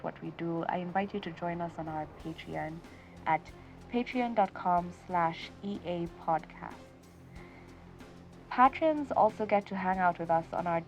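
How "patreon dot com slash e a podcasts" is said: ordinary delivery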